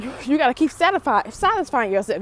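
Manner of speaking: usual speaking voice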